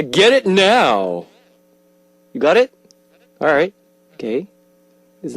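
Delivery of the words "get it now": "Get it now" slow, higher and louder, drawn out; performed promo line. Shown as a demonstration. Host records the requested clip for a fan, slow and performed. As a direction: deliver "Get it now" like a seductive tagline. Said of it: "Here we go".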